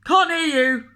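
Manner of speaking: loud, high boomed shout. Acted. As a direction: boom it over the counter. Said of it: "boomed the butcher".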